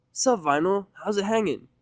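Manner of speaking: brash tomboy saying hi; Rainbow's voice. Acted. Rainbow greeting Vinyl.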